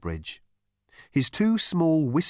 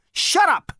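high, loud cut-off; irritated snap; 7 dB louder than usual. Acted. Zaphod silences Arthur's question, irritated and loud.

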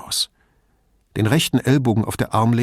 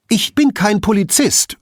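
sharp, high, louder snap; snapped; 12 semitones higher, 6 dB louder than usual. A narrator's reading. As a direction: bite back at him. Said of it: "fauchte sie".

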